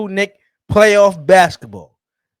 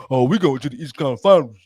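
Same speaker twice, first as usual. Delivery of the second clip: fast, low mocking fan boast; quick lowered impression of a bragging fan; mock Knicks fan; 7 semitones lower, faster than usual. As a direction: rattle it off low like a smug fan. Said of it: "But no".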